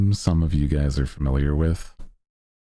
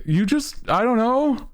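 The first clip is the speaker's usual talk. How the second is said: shocked viewer voice. Retold or imitated. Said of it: "I was like".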